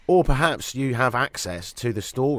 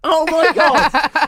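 a slow, drawn-out, much higher and louder cry; mock outrage; 10 dB louder, much slower than usual. Real, not acted. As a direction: act scandalised by the weak praise, stretching every word. Shocked at a lukewarm endorsement.

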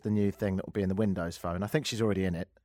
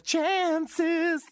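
mock American accent; 4 dB louder than usual. Shown as a demonstration. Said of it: "have a go at the accent".

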